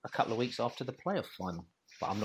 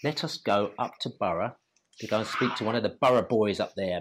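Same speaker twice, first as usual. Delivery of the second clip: usual speaking voice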